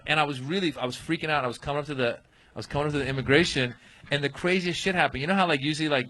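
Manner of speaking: normal talking voice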